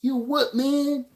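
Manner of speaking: high-pitched mock confusion; playfully imitated high "what?"; imitating baffled friend. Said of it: "He's like".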